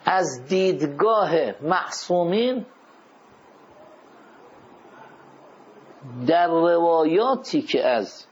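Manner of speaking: measured phrases with long pauses to write; slow dictation. Shown as a demonstration. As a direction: dictate slowly for note-takers. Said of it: "یادداشت کنید".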